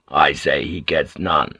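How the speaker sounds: low-pitched, stubborn sailor; gruff refusal. A narrator's reading. Sailor voting against water for the captive, gruff.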